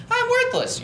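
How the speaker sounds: high whiny mock despair; high hammed-up whine of self-pity. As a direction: play the defeated dieter, hammed-up.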